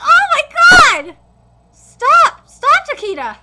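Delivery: high, loud, alarmed; frantic shouting. Real, not acted. Fan shouting as the fight ends. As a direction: yell for him to stop. Shocked reaction to finish.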